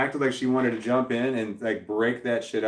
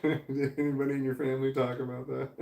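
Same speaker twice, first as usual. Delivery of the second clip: laughing; 3 semitones higher than usual. Real, not acted. Laughs while asking the viewer.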